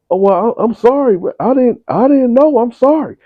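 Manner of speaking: mock a scared son apologizing; panicked apology.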